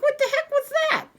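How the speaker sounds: high, incredulous what the heck; stunned disbelief. Retold or imitated. After the shopper hops away, high stunned disbelief. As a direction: squeak it out in disbelief.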